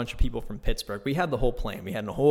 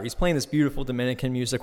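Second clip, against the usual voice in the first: normal talking voice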